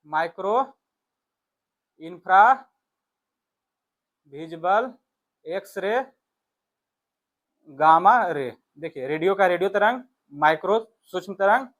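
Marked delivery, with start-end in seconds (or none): none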